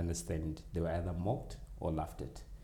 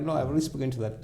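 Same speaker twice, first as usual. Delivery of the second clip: put-on kid brushing it off; 7 semitones higher, 5 dB louder, much faster than usual.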